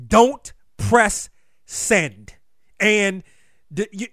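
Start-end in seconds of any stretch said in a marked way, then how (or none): 0.1-2.3 each word set apart, slow and firm; slow quoted advice. Retold or imitated. Host quoting Herm Edwards' advice to listeners, slow and firm. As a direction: quote the old coach's rule, slowly. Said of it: "Herm Edwards used to always say".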